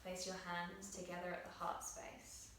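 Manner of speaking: soft, hushed meditation guidance; guided meditation. Acted. Yoga teacher guiding students through breath on their mats, soft meditative voice. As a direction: guide the class gently into breath awareness.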